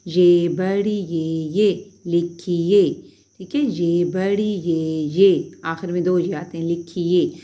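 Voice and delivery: very slow, drawn-out ये, बड़ी ये; slow dictation. Shown as a demonstration. Teacher dictating letters for students to write down. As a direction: dictate each letter slowly. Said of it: "dictating letters slowly".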